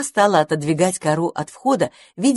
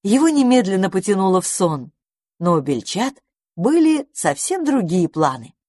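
plain reading voice

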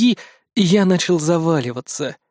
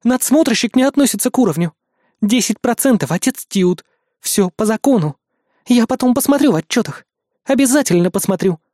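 high, firm, insistent noblewoman; commanding countess; 6 semitones higher than usual. Acted. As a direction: press the point, haughty and firm. Countess voiced in story.

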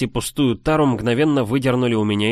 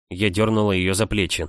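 plain reading voice

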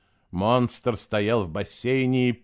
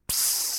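long sharp hiss; 5 dB quieter than usual. A narrator's reading. Narrator imitates a hiss.

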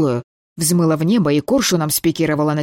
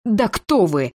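higher snarl with a touch of growl; 5 semitones higher, slower than usual. A narrator's reading. The girl snarls at the man holding her mother. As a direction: bite the question off like a growl. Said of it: "рыкнула".

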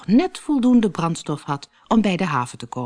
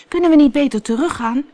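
higher, louder, fast anxious call; 9 semitones higher, 5 dB louder, faster than usual. Acted. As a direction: hurry the question out over the storm. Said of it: "riep U K naar de sleepboot".

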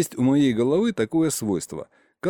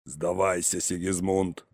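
low, softer voice of an opponent; Козлов negotiating; 6 semitones lower, 6 dB quieter than usual. A narrator's reading. Козлов urging uncle to surrender, low and restrained. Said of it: "снова начал Козлов".

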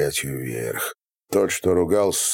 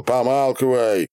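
higher-pitched silencing shout; curt shout; 6 semitones higher than usual. A narrator's reading. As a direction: shut him up with a shout. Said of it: "крикнул Ярыга". (резкий окрик)

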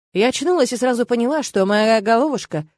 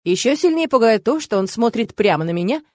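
ordinary delivery

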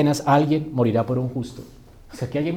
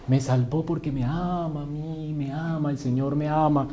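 remedo blando y lento de canción sentimental; imitando canciones de moda; 5 dB quieter than usual. Retold or imitated. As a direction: arrastra las palabras, bajito, como un cantante meloso. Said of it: "Estas canciones de ahora". (parodia de canción de alabanza)

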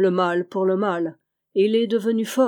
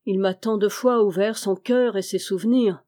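ordinary delivery, nothing marked

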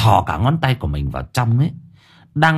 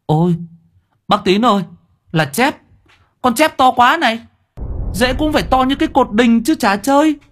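astonished shout; 13 semitones higher than usual. A narrator's reading. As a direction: shout it out as if you can't believe the size. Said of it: "trố mắt".

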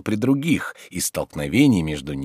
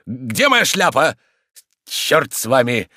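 angry parting; 7 semitones higher, 5 dB louder, slower than usual. Acted. Storming out of the house.

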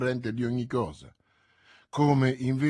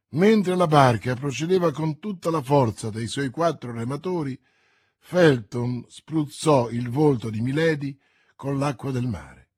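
plain reading voice

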